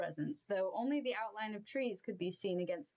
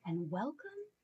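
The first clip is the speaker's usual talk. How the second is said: ordinary delivery, nothing marked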